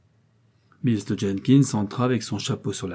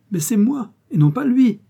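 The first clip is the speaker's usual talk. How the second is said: much higher and louder, rising indignation; a young man aghast at the plan, crying out; excited outburst; 13 semitones higher, 7 dB louder than usual. A narrator's reading. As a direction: protest it in a high, loud burst. Algernon's outburst continues.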